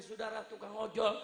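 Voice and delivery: ordinary talk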